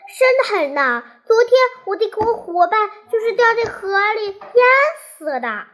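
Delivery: squirrel's earnest warning, pitched high midway; earnest, lightly acted storytelling alarm. A narrator's reading. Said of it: "松鼠认真地说".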